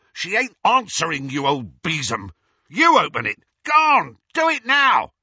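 high, loud furious screaming. A narrator's reading. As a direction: scream the demands. Amycus screaming at McGonagall.

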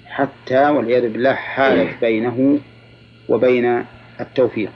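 normal talking voice

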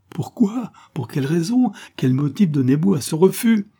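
high-pitched, insistent questioning; desperate demand. Acted. Rejected lover demands the motive of refusal, desperate. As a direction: question urgently, pitch leaping up. Harry demands a reason again.

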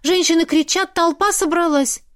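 worked up, pitched high; agitated. A narrator's reading. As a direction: voice an agitated girl bringing news. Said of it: "встретила взволнованную".

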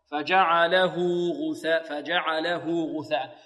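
slow chanted "فجعله", ending in long -hu; joined ha with waw. Shown as a demonstration. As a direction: stretch the ha of "فجعله" into a two-count "huu". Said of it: "الهاء نصلها بواو لفظية".

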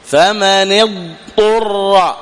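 very slow فمن اضطر with a full ض; slow careful recitation. Shown as a demonstration. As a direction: ease into the ض of اضطر. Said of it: "هكذا".

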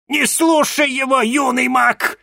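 very high, loud warning cry; shout. A narrator's reading. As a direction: yell the warning across the room. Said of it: "крикнула мадам Анаит".

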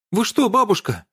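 high shocked squeal. A narrator's reading. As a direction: squeal out in pain. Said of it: "взвизгнула Лисхен".

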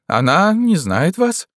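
high, slow, pointed statement; pale smile. A narrator's reading. Companion dismisses the young man, with a thin smile. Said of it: "с бледным подобием улыбки".

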